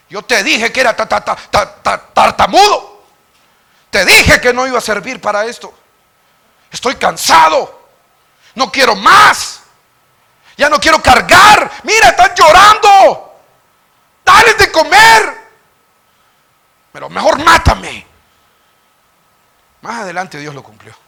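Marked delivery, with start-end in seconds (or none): 0.1-3.1 stuttering complaint. Acted. Moses mocking his stutter. 3.9-18.1 high, loud, slow shouted grievance; exasperated complaint. Acted. Moses telling God he is tired of the people, exasperated shouting.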